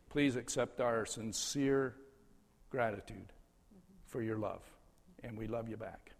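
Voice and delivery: soft, heartfelt thank-you; tender, quiet farewell; sincere gratitude. Real, not acted.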